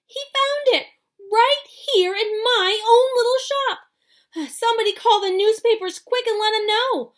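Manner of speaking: high, loud, frantic shouting; excited yelling. A narrator's reading. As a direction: holler it to the crowd. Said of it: "he yelled".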